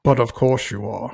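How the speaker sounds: light imitation of a smooth, dry Scottish agent; a hint of Connery's suave put-down; mildly imitating Sean Connery's dry reply; Sean Connery impression. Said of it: "And then Sean Connery goes".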